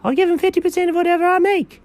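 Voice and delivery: high, fast put-on voice; Chuck character voice. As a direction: rattle it off as Chuck.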